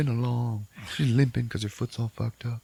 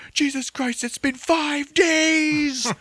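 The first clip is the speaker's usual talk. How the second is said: much higher, very slow exasperated voice; mock outraged Lane; 4 dB louder, much slower than usual. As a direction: drag it out in a high put-on wail. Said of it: "Lane's just like".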